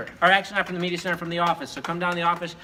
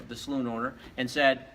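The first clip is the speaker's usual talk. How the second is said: ordinary delivery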